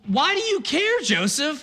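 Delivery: incredulous disbelief. Retold or imitated. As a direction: pitch jumps way up, asking in disbelief. Preacher's own incredulous reaction.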